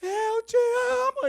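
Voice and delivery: high loud I love you; shouted answer. Retold or imitated. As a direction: yell it up to the rooftop.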